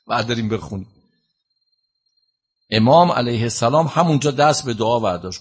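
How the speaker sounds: ordinary talk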